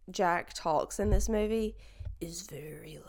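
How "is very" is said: whispered. Shown as a demonstration. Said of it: "it's very low".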